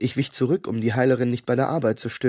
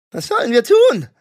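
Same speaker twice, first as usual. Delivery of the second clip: much higher, loud alarmed question; panicked shout; 11 semitones higher, 8 dB louder than usual. A narrator's reading. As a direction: demand orders in panic. Panicked question under guns.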